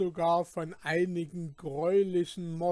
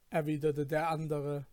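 usual speaking voice

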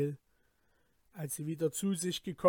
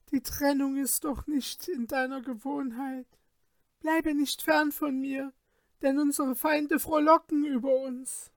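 wailing lament; 8 semitones higher than usual. A narrator's reading. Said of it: "fing sie an zu weinen und zu schreien".

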